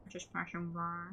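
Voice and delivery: slow, soft slurred mumble; trailing, slurred mock excuse; mocking echo. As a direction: mumble the excuse mockingly under your breath. Mocks the flimsy excuse again.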